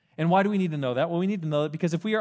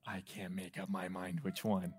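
put-on much softer, lower, dithering child voice; indecisive kid; 8 semitones lower, 14 dB quieter than usual. Kid at the store dithering over candy, soft and low. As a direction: whine it quietly like a stuck kid.

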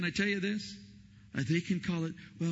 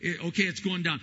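ordinary delivery

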